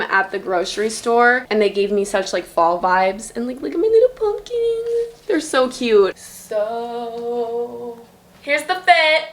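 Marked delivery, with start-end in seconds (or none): 3.3-9.2 high, bouncy put-on British accent, pumpkin as litə; showing off her flowers in a jokey British accent. Vlogger to viewers, playful fake British accent. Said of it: "I keep talking in this British accent".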